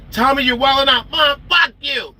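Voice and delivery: high, mock parent and bratty child; hammed-up high-voiced squabble; acted family quarrel. Acting parent and defiant child quarrel.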